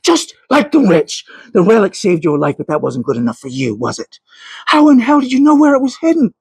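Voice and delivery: angry scolding. A narrator's reading. Thomas berates Catherine over the stolen relic, furious. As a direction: berate her for the trouble she caused.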